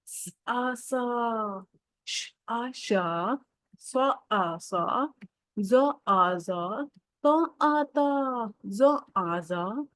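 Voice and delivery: very slow, drawn-out syllables to repeat; slow read-along. Shown as a demonstration. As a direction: stretch each sound so kids can follow. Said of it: "मेरे साथ पढ़ते जाए". (बहुत धीमे, खींचकर साथ पढ़वाना)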